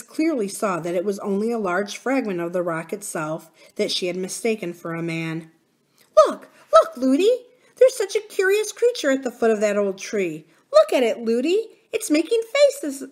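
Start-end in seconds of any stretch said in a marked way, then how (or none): 6.2-13.0 put-on very high, loud little-girl exclamations; excited child princess. A narrator's reading. Princess showing nurse a curious creature, high excited chatter. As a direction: point it out in a high, eager voice.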